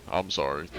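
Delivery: ordinary talk, nothing marked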